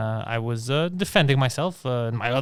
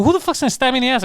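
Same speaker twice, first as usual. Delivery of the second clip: incredulous; 10 semitones higher, 3 dB louder, faster than usual. Retold or imitated. Retold outraged question.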